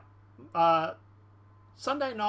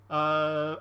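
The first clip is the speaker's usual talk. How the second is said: usual speaking voice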